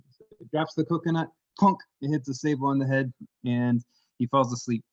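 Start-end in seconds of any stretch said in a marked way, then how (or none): none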